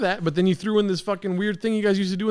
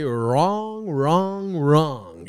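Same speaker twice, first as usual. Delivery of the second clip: mocking imitation; 3 semitones lower, much slower than usual. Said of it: "and move your head like that".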